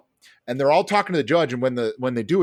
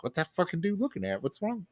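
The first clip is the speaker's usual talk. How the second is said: confused inner thought; 6 semitones higher, 9 dB quieter than usual. Retold or imitated. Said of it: "I'm like".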